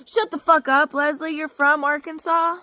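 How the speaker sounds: real angry rant at Leslie, loud and high; angry outburst. Real, not acted. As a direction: tell her off hard. Angry retort to mocking pastor.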